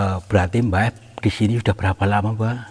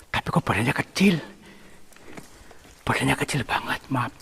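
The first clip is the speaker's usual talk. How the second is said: higher, half-whispered aside; hushed puzzlement; 4 semitones higher, 7 dB quieter than usual. Real, not acted. Explorer puzzled by the small figure, hushed. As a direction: murmur it in baffled wonder.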